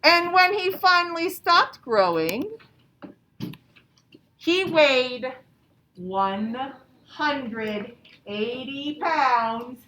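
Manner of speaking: loud, drawn-out build to the big number; big reveal for the class, slowed right down; dramatic storytelling. A narrator's reading. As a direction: make the size sound huge.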